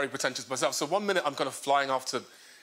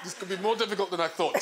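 ordinary talk

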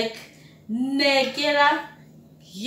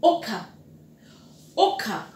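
ordinary talk, nothing marked

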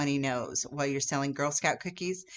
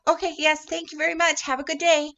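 much higher and louder, bubbly thanks; overjoyed little seller thanking the customer; delighted girl; 10 semitones higher, 4 dB louder than usual. Retold or imitated. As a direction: gush cheerfully as if the no made your day. Girl happy about a no.

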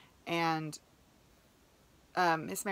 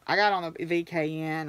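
usual speaking voice

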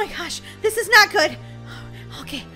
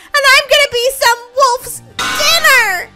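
high, loud, drawn-out despair; panicked wail; 6 semitones higher, 6 dB louder than usual. Acted. Orphan girl fearing she will be eaten, wailing. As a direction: wail it, stretching the last word.

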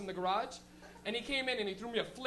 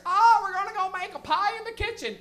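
lightly voiced parent, higher and louder; mock parent; 7 semitones higher, 8 dB louder than usual. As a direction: imitate a parent announcing plans. Mocking parents in a bit.

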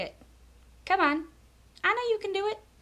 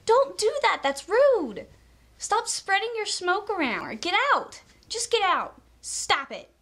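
indignant order; 6 semitones higher than usual. Real, not acted. Vlogger ordering smokers to leave, indignant telling-off. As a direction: kick the smoker out.

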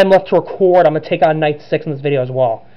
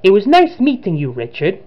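put-on high, amateur Italian accent; bad Italian accent, starting high and falling away; Luigi; 3 semitones higher, 8 dB quieter than usual. Villain bids Richard farewell in a cutscene, put-on Italian accent. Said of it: "I did a bad Luigi voice".

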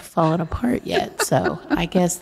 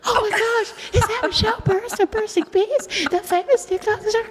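mock fan gushing. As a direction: gasp out like starstruck fans. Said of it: "would turn around and went".

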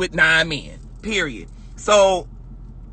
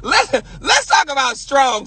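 shouted challenge; 7 semitones higher than usual. Acted. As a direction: hammer the challenge home.

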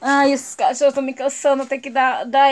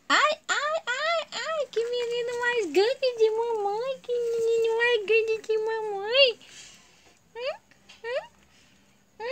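doting singsong pet talk; 10 semitones higher, 6 dB quieter, slower than usual. Real, not acted. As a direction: coo adoringly, slow and high. Cooing over her pet.

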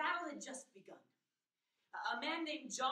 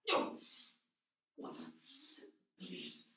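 repeated desperate cries; pleading at gunpoint; 4 dB louder than usual. Acted. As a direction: scream no, begging for your life. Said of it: "pleading at gunpoint".

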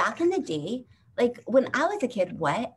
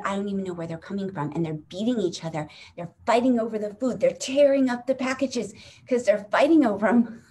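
ordinary talk, nothing marked

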